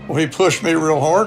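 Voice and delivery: plain reading voice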